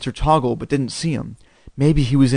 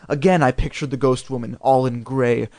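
ordinary delivery

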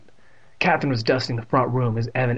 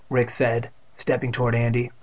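ordinary delivery, nothing marked

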